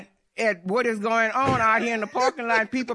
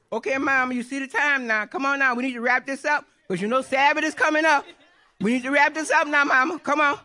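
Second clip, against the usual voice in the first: quick, higher-pitched imitation of an impatient son; urgent hurrying; 3 semitones higher, faster than usual. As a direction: rush her along, mildly pushy. Son hurrying mother before Sabbath.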